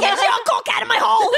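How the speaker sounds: strict dorm mother. Pretend.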